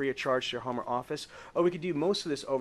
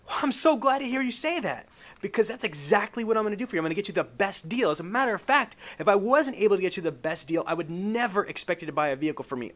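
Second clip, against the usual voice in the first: higher, louder, bright-eyed pitch, rushing ahead; eager sales pitch; 6 semitones higher, 4 dB louder than usual. Acted. Salesman pitching Sandra on the best deal, higher and louder with enthusiasm.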